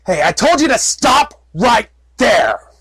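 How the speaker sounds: loud, slow, high yell; warning shout. Acted. Guard shouting at an approaching stranger, loud and slow. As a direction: bark the warning from far off.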